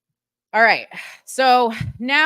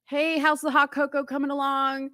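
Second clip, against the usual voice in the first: casually unbothered, imitating the father; mock blasé father; 4 semitones higher than usual. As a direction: toss it off like nothing is wrong. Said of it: "completely just blasé".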